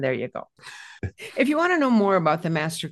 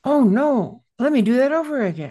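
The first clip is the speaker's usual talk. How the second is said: louder, higher cry of dismay; real dismay; 5 semitones higher, 5 dB louder than usual. Real, not acted. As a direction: exclaim at your own slip. Catches own mistake in web address.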